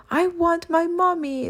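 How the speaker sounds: put-on high, small whimpering child; whimpered. A narrator's reading. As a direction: whimper it like a lost little girl. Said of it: "the girl whimpered".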